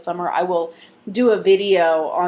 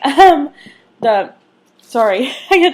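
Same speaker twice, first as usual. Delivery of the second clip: laughing; 6 semitones higher, 9 dB louder than usual. Real, not acted. Laughing at distraction.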